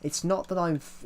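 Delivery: ordinary talk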